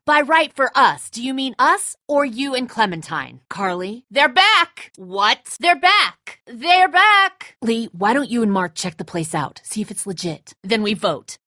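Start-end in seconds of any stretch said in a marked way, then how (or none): 4.1-7.6 high, loud calling out; excited calling out. Acted. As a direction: shout the news to everyone. Calling out the group's return.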